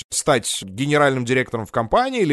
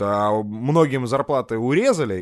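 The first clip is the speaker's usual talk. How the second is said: ordinary talk, nothing marked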